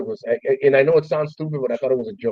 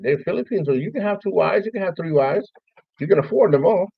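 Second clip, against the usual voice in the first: higher-pitched teasing argument, retold; playful retold protest, pitched up; joking comeback; 6 semitones higher than usual. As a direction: argue it back in a higher, mock-reasoning voice. Acted comeback in retold banter.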